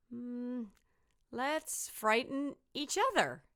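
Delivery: put-on a sly kid with a plan; little skeleton. Little skeleton suggests frightening each other, high and sly. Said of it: "he said".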